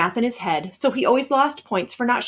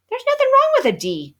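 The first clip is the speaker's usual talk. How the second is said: high-pitched nerdy protest; a much higher-voiced know-it-all kid; arguing; 3 dB louder than usual. A narrator's reading. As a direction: defend yourself in a squeaky genius voice. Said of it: "he argued".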